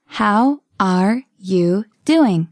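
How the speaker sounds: very slow, each word separate; slow model. Shown as a demonstration. Teacher modelling a question for learners, very slowly. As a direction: pause between the words.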